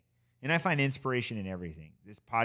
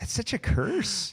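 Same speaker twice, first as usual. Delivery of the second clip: mock horror; 9 semitones higher, slower than usual. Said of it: "And I was like".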